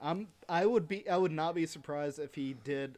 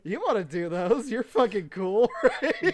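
mock ad pitch; 4 semitones higher, 6 dB louder than usual. Mock voicing Popeye marketers.

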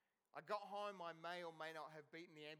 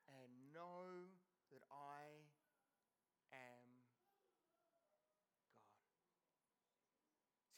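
slow, much softer, fading to a breath on God; quiet scripture quote; 4 semitones lower, 9 dB quieter, much slower than usual. Acted. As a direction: let each word fall away into stillness. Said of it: "He says".

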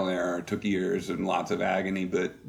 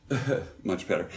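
ordinary delivery

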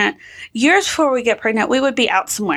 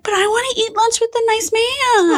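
put-on high pleading child voice; imitating whiny little girl; 11 semitones higher than usual. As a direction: plead like a small kid. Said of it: "she's like".

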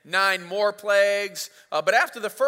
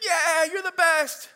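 high, loud hammed-up cheer; crowd cheering; 10 semitones higher, 5 dB louder, slower than usual. Preacher voicing Israelites cheering for Moses again, high mock cheer. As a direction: cheer like fickle fans. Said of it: "Everybody's like".

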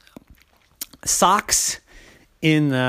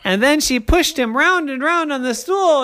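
usual speaking voice

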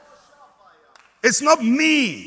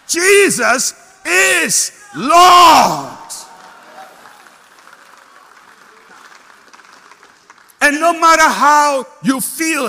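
drawn-out shouted proclamation, much higher and louder; full-force preaching cry; 5 semitones higher, 9 dB louder, slower than usual. Real, not acted. Preacher to church, shouted climax. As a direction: cry it out slowly like the climax of a sermon.

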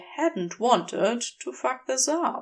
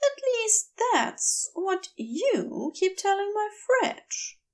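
lightly higher, gentle angel voice; Aziraphale voice; 10 semitones higher than usual. A narrator's reading. Aziraphale teasing.

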